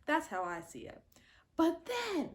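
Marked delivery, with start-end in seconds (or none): 1.6-2.3 high, loud, indignant telling; outraged at the betrayal; outraged retelling. Real, not acted.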